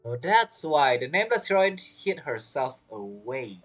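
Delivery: ordinary talk, nothing marked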